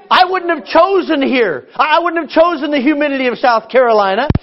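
mock-indignant preaching rant; comic rant. Real, not acted. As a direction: pile up the gripes with mock outrage. Comic complaint list, raised voice.

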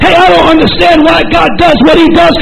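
ordinary talk

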